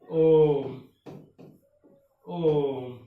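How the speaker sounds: ओम held very long on a steady note; prolated vowel. Shown as a demonstration. Teacher dictating examples of the prolated vowel, ओम drawn out. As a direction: stretch each ओम to triple length. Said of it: "तीन गुना समय लगता है".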